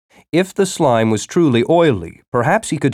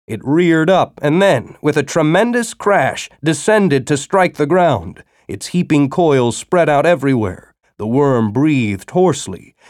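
ordinary delivery

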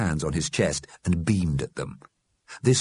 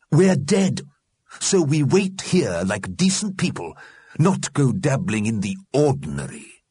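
stern Mister Grimm; 7 semitones higher, 4 dB louder than usual. A narrator's reading. Mister Grimm tells the dead to wait, stern. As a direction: preach decency to them.

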